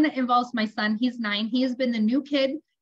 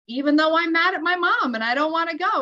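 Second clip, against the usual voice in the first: higher, emphatic modeling of a phrase; firm tapping prompt; 6 semitones higher, 4 dB louder than usual. Retold or imitated. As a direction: lead an upset child through the words. Mother models tapping phrase for angry son.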